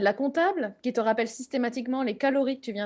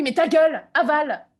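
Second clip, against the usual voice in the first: irritated snap; 3 semitones higher, 6 dB louder than usual. Acted. Snapping at the chewing accountant.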